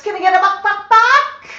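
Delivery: high, loud sing-song buck buck; high squeaky coaxing to get the parrot to cluck; coaxing buck call. Shown as a demonstration. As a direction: call it up high to coax the bird. Coaxing parrot to make sound.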